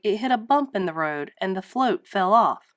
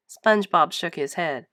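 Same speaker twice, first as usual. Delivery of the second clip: ordinary delivery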